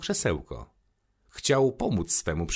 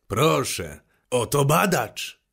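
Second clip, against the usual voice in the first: wyżej, głośniej i wolniej, jakby witał gościa; radosny okrzyk; 6 semitones higher, 4 dB louder, slower than usual. A narrator's reading. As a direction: wykrzyknij z radością na widok przybysza. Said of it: "wykrzyknął". (stary geograf wita przybysza radosnym okrzykiem)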